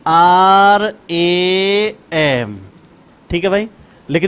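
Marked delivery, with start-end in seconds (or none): 0.0-2.6 R A M spelled letter by letter, very slowly; drawn-out letter-by-letter English spelling. Shown as a demonstration. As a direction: spell out R A M deliberately for the class. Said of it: "अंग्रेजी में रूपांतरण करें".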